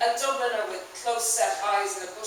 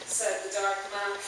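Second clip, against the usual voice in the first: usual speaking voice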